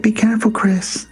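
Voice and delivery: high-pitched put-on wife's voice; mock concerned plea; worried wife. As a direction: imitate an anxious wife, pitched well up.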